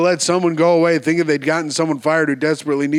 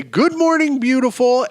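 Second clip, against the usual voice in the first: high, chirpy good-morning call; bright, happy morning greeting; 8 semitones higher than usual. Shown as a demonstration. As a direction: greet the day bright and bushy-tailed. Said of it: "I wake up every morning bright".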